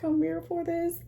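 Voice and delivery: high-pitched playful quoted squeal; excited mock reaction. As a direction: act out a delighted reaction way up high. Acted excited reaction to the makeup.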